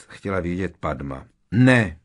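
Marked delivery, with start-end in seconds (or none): 1.5-2.0 short, loud, higher-pitched no; snapped refusal. A narrator's reading. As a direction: snap it back curtly. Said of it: "odsekl Ron". (úsečné odseknutí)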